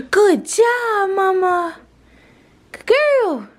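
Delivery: squeaky praise for a good dog; high dog praise voice. Real, not acted. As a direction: gush over the dog, way up high.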